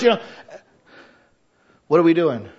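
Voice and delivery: usual speaking voice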